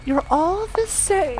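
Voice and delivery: put-on a young girl turning down a stranger, prim and high; wary girl. Girl refusing the stranger's ride, high and prim. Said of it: "so here are the lyrics".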